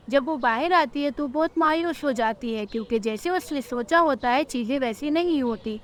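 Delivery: ordinary delivery, nothing marked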